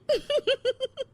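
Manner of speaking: high, mocking little laugh; smug laugh. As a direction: laugh at her defeat. Smug laugh after insult.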